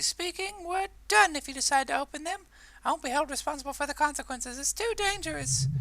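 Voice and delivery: high falsetto warning; petrified shop owner. Acted. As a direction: warn shrilly as a frightened shopkeeper. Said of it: "The petrified voice of the shop owner".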